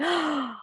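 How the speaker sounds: high, soft intake of breath; delighted gasp. Retold or imitated. Listener recalling the ghost leaving the grave, delighted gasp. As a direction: gasp as if thrilled. Acts out reaction to happy ending.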